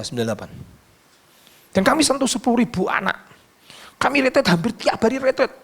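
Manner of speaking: ordinary talk, nothing marked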